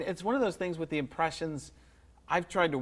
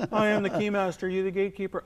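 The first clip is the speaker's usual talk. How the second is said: Vince Clortho quote; 6 semitones higher, 3 dB louder than usual. Acted. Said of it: "Vince Clortho was a tremendous character".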